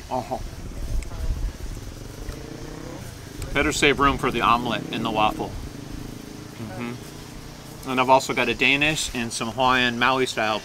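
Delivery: chewing between words; talking while chewing. Real, not acted. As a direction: mouth partly full of fish. Seen 2: Poke taken in at -3; chewing frames 0-2.9, next bite at 5.3.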